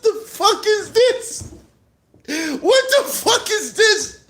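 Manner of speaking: high-pitched, stretched-out disbelief; incredulous laughing cry. Retold or imitated. Viewer baffled by the diss video, hysterically incredulous. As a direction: squeal it, nearly crying with laughter. Acts out reaction to the splash.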